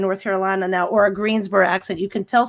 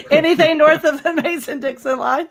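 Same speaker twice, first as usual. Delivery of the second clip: laughing; 7 semitones higher than usual. Real, not acted. Joking answer, laughing.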